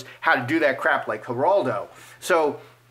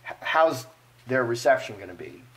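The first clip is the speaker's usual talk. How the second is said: ordinary delivery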